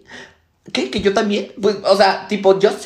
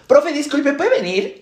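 raised, louder polite summons; calling out; 7 semitones higher, 3 dB louder than usual. Acted. Student calling the teacher over for help, calling out. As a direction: call the teacher over from your seat.

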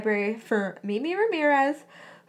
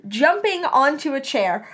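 ordinary delivery, nothing marked

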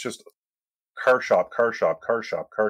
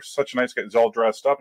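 usual speaking voice

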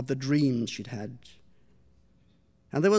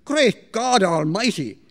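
loud, slow, shocked outcry; startled exclamation; 5 semitones higher, 9 dB louder, slower than usual. Acted. Mistress startled by kitten from oven, loud outcry. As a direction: blurt it out in alarm at the darting cat. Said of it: "exclaimed Missus Shaughnessy".

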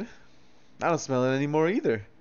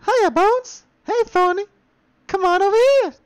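much higher, fast goofy voice; silly squeaky character; 7 dB louder than usual. Pretend. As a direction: squeak it like a dim little critter. Voicing a character.

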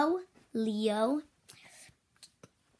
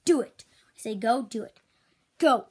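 ordinary talk

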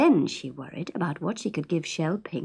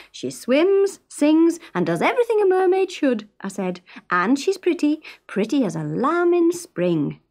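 higher, louder boastful selling; salesman's pitch; 8 semitones higher, 4 dB louder than usual. Retold or imitated. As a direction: talk her up like a showman. Fred mimics his own pitch.

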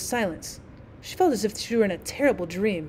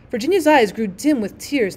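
plain reading voice

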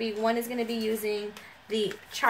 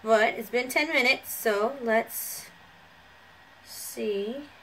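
stiff mask limiting mouth; through a face mask. Real, not acted. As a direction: lips barely moving under mask. Seen 2: Wet sheet mask covers face and mouth area while talking.

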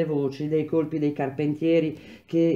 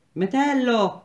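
a much higher, louder call of Metello; shouted name; 8 semitones higher, 4 dB louder, slower than usual. A narrator's reading. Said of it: "gridò il piccolo Renzoni".

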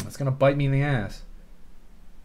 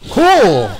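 excited; 8 semitones higher, 8 dB louder than usual. Real, not acted. Reacts to game event.

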